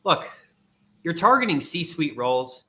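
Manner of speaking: ordinary delivery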